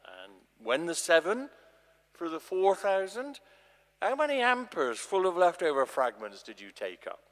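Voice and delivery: Jesus scolding disciples who will not understand; rebuking Jesus. Acted. Jesus chides his disciples, loud and exasperated.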